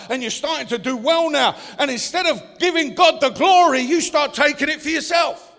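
ordinary talk

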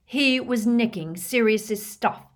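loud, high, slow indignation; indignant, leaning hard on each word; angry outburst. A narrator's reading. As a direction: burst out in outrage. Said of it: "Harry burst out".

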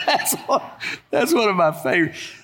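real laughter spilling into the words; laughing. Real, not acted. Preacher laughing at his own pony story. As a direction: laugh through it, enjoying the punchline.

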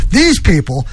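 normal talking voice

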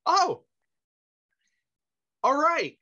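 high, surprised Walken voice; dawning Walken realization; Walken impression. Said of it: "He goes".

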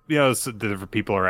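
ordinary talk, nothing marked

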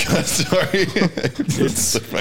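high-pitched, helpless laughing through the words; cracking up. Real, not acted. Co-host losing it at the listener's voice message, cracking up. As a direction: apologise while laughing, words stretched out.

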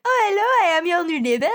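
high, hammed-up French lady; terrible French accent. French lady greets new neighbours, terrible French accent. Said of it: "the worst French accent ever".

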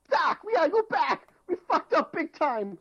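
high, slow crying shout; hammed-up panicked cry to Doc; panicked Marty. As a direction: wail it out like a desperate Marty.

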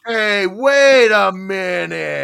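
loud, slow, drawn-out halt; mom catching on. Retold or imitated. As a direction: imitate mom stopping everything as it dawns on her. Mom catches on in retold story.

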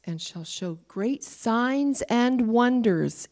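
slow, solemn Bible reading; preacher reading scripture to the church. Acted. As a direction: read the verse slowly and gravely. Reads scripture aloud.

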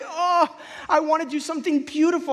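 high, loud, hammed-up yearning; mock tearful. Preacher imitating himself moved by Chef's Table, mock tearful. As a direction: wail it as if moved to tears, in jest. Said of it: "I will cry every time I'm like".

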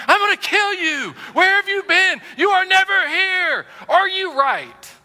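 high-pitched, loud outburst, drawn out; hammed-up exasperated spouse at full volume; furious yell. Wife to husband home from trip, furious yelling.

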